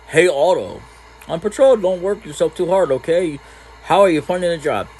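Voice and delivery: lightly acted, casual concern; friendly villager. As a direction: greet the young guard lightly.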